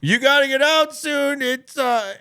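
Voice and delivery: high, slow, drawn-out shout; calling through door. Retold or imitated. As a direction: holler it through a bathroom door, imitating staff. Staff call through door at closing.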